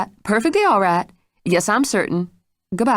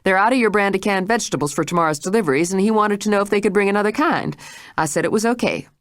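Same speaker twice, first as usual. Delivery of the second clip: fast, curt excuse; short, curt; 5 semitones higher than usual. A narrator's reading. Maid answers Jane curtly and fast. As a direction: brush her off quickly. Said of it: "she said shortly".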